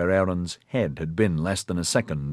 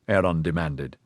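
usual speaking voice

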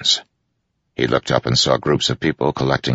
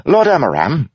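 calling out; 5 dB louder than usual. Acted. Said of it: "Wit called".

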